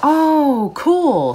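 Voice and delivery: sudden high, loud delighted burst; excited. Real, not acted. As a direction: squeal with delight at the find.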